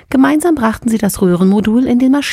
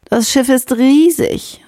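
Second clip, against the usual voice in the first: sighing; 7 semitones higher, slower than usual. A narrator's reading. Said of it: "seufzte Ella".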